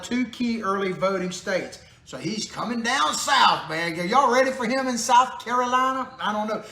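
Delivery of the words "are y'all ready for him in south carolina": hint of Southern accent. Vlogger teasing South Carolina viewers in a light Southern accent. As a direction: toss off a playful Southern "y'all". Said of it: "do I need to fake a Southern accent?".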